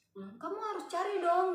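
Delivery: high, whiny retold demand; imitated nagging demand; pushy scammer. Retold angry scammer pressing him.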